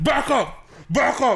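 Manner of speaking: impersonated threat. Retold or imitated. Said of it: "he's like".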